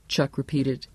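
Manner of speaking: ordinary delivery, nothing marked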